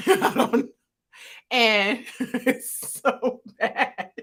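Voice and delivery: slow words broken by real laughter; cracking up, barely getting it out; can't stop laughing at the meme. Real, not acted.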